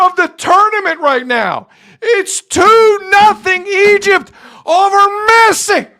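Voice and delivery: loud, high, drawn-out excited hollering; yelling like a manager. Real, not acted. Host on Egypt stunning Messi, yelling in high disbelief. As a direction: holler the shock score, stretching the big words. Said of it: "just yelling at someone in the hallways".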